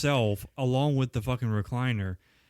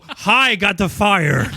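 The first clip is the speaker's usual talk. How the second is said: higher, much louder goofy voice; mock dim-witted greeting; 6 semitones higher, 11 dB louder, slower than usual. Mocking Fran dithering with the match, loud goofy voice. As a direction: play it dumb and chirpy. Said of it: "Don't sit there and go".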